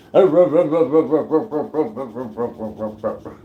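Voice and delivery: long rapid babbled burbling; playful lip-flapping babble. Pretend. Dad making his baby laugh, silly babbling noise.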